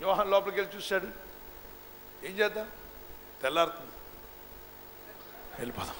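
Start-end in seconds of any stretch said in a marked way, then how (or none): 2.2-5.9 soft, hesitant aside, trailing away; hushed conferring. Acted. Disciples quietly deciding to leave the tomb, hushed. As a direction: confer softly, with pauses, dropping lower.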